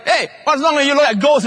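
high, loud mock complaint; blunt husband. Preacher mocking a married husband at breakfast, high and loud. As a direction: toss it off like a grumpy husband. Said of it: "you wake up and say".

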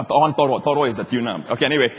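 ordinary talk

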